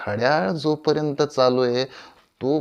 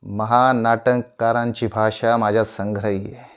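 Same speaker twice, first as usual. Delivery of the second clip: ordinary talk, nothing marked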